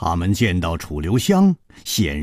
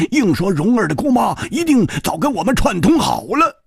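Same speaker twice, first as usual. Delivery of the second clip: higher, brisk swordsman recounting the interrogation; Hu Tiehua's voice; 9 semitones higher, 3 dB louder than usual. Acted. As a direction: tell it lively with rising indignation.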